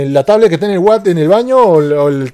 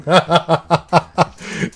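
laughing. Real, not acted. Laughing at Samsung joke.

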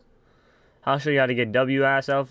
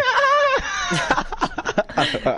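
high-pitched burst of laughter; laughing. Real, not acted. Streamer cracking up at friend's roast, laughing.

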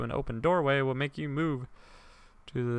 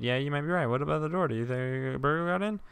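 ordinary talk

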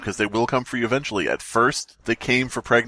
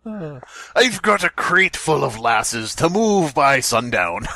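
put-on character voice; 3 semitones higher, slower than usual.

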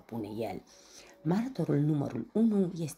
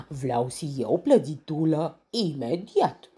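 howling lion; 4 semitones higher, 6 dB louder than usual. A narrator's reading. Lion demanding cake at once, loud lisping roar. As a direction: bellow it like a spoiled lion king. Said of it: "urlă leul".

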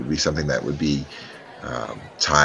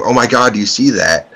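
loud alarmed cry of shock; mock scream; 5 semitones higher, 7 dB louder than usual. Player's character screaming to distract the wealthy woman. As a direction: yell it out to draw the crowd. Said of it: "and then scream".

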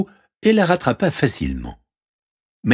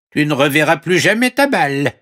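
high, firm frog voice; talking frog; 9 semitones higher, 4 dB louder than usual. Acted. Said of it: "dit la grenouille". (voix aiguë de grenouille)

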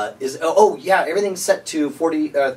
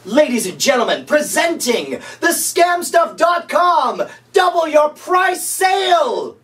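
high, slow, booming announcer yelling the deal; shouted sales announcement; 11 semitones higher, slower than usual. Acted. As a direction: sell it like a carnival barker. Mock infomercial announcement.

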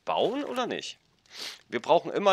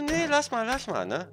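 imitating girlfriend; 11 semitones higher than usual. Freundin nachgeahmt, höher.

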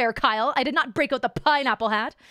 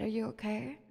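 hushed romantic; 3 semitones lower, 10 dB quieter than usual. Retold or imitated. Tender moment with instructor.

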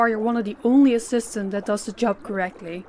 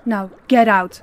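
louder barked dismissal; angry boss shouting him out; shouted order; 7 dB louder than usual. Acted. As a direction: throw him out.

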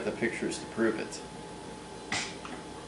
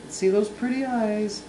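much higher coaxing coo; 9 semitones higher than usual. Real, not acted. Dad coaxes baby to open eyes.

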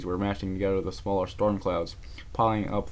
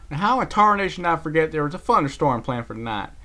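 country cowpony voice; 8 semitones higher, 7 dB louder than usual. Acted. Applejack exclaims at herself.

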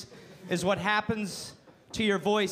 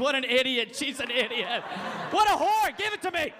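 hammed-up macho scorn; rowdy buddy; 4 semitones higher than usual. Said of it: "buddies mocking girlfriend".

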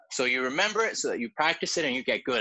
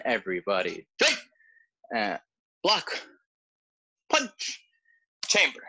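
drill commands; slower than usual. Acted. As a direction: bark each move for the class. Calls out the form moves.

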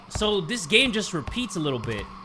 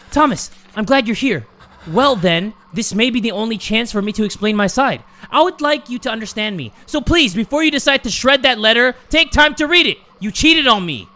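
declaimed letter, building louder and higher; dramatic letter reading; 5 semitones higher, 6 dB louder than usual. Acted. Player reading a letter from a cheated-on partner, dramatic reading. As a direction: read it out with mounting theatrical reproach. Reads in-game letter aloud.

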